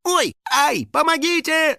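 high-pitched cry for help; panicked yell. Acted. Finik trapped under the skull, yelling for help. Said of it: "заорал Финик".

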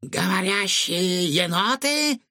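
slow, high, drawn-out disbelief; flabbergasted. A narrator's reading. Cook hears raccoons talk, flabbergasted. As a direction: stretch it out in high astonishment. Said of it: "вытаращил глаза Чингисхан".

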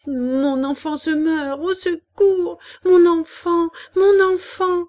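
high, drawn-out wail for help; terrified cry. A narrator's reading. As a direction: build from anguish to a loud, desperate cry. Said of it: "s'épouvantant, bégayant". (cri de terreur désespéré)

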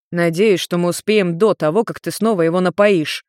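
ordinary delivery, nothing marked

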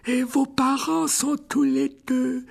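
put-on high, slow frail old wizard; old headmaster voice. A narrator's reading. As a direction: greet him like a frail elderly headmaster. (voix aiguë et lente de vieux directeur)

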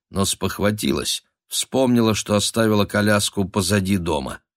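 usual speaking voice

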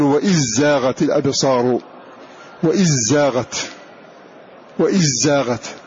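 وإذ زاغت said "wa-izzaghat", with a doubled ز; dh merged into z. Shown as a demonstration. Teacher showing students the merge: وإذ زاغت as "wa-izzaghat". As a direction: run the ذ into the ز of زاغت. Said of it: "فنقول".

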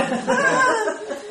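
a sudden high laugh; real amused laugh at the dead-dog joke; high burst of laughter. Real, not acted. Laughing at his own joke.

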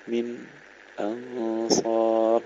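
"من", a brief silence, then drawn-out "أنصار"; sakt. Shown as a demonstration. Teacher shows Khalaf's third option, sakt pause. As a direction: halt after "من" before the hamza of "أنصار". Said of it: "له النقل والتحقيق والسكت".

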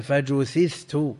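فجثثت drawn out as "fa-ju-THITH-tu"; بثاءين. Shown as a demonstration. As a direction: say فجثثت slowly with two th sounds and no hamza. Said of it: "بثاءين". (نطق «فجثثت» ببطء بضم الجيم وثاءين)

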